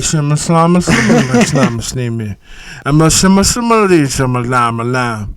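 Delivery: nonsense mumble imitating himself; mumbling. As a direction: run sloppy syllables together like a hammed-up mumbler. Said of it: "I have a mumbling problem".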